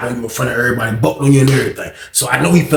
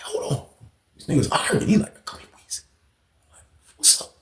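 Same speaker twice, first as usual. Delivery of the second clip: whispered; 5 semitones higher, 10 dB quieter than usual. Retold or imitated. Talking with Tim during the standoff, a whisper. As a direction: keep it to a whisper.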